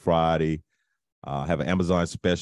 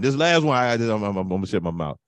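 ordinary talk, nothing marked

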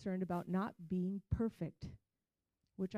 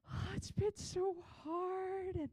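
high, slow, pitiful self-pity voice; milk the sympathy in a high slow whine; a mock-tearful young woman pleading for pity; whiny sob story; 8 semitones higher, slower than usual. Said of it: "release all this sob story".

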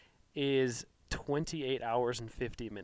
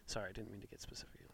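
whispering; 4 semitones lower, 12 dB quieter than usual. Real, not acted. Sheepish aside.

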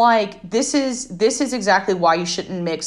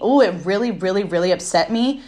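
ordinary delivery

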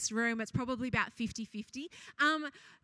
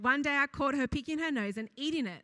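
ordinary talk

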